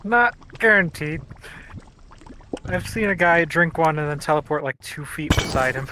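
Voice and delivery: high and loud, eager; excited. Real, not acted. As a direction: burst out with excitement. Excited story about a teleport.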